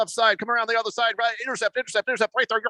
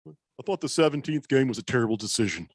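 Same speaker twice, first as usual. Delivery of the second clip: put-on lower, sleepy old grandpa read; a lightly voiced old grandpa; 7 semitones lower, 4 dB quieter than usual. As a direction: read it like a tired grandpa who should be in bed. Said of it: "let's get you back to bed, Grandpa".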